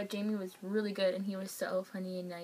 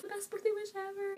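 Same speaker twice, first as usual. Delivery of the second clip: much higher, breathless thrilled exclamation; giddy squeal; 12 semitones higher, 3 dB quieter than usual. Retold or imitated. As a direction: squeal it like a fan who can't believe it. Singer wishes her happy birthday.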